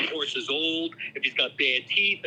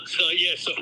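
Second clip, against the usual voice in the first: ordinary talk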